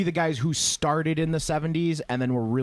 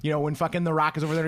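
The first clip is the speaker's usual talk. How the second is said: normal talking voice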